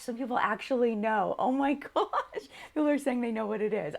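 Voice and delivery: laughing, excited disbelief; delighted surprise. Real, not acted. Delighted viewers guessed the mug.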